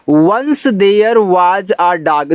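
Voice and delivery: once there was a dog read very slowly, word by word. Shown as a demonstration. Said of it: "यानी कि". (धीरे धीरे पढ़ना)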